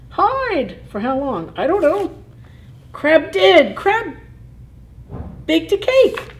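high, loud, excited cries; excited fish voices. Pretend. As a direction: squeal it out like frightened little fish.

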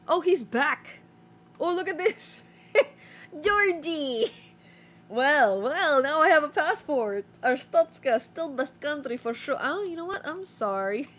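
loud, high hammed-up entrant voice, broken English; returning entrant pleads at the border, loud and hammed-up. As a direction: play the pushy returning traveller, loud and high. Voicing returning entrant Georgie.

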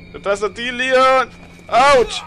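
sudden high, loud cry of fright; frightened shout at a game monster; startled yelp. Real, not acted. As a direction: jump and yelp as you get hit.

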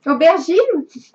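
"Aubergine" said the French way; French pronunciation. Real, not acted. Querying son's laughter at the word, French-sounding "Aubergine". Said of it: "I probably pronounce it the French way".

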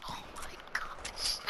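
slow, awed whisper. Real, not acted. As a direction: breathe it out in disbelief. Tense moment, awed.

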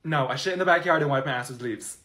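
normal talking voice

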